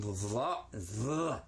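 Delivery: long buzzing TH at the start of the syllable; heavy-duty TH. Shown as a demonstration. Teacher models the letter for pupils, heavy-duty TH. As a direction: slowly lean into the voiced TH. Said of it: "heavy duty".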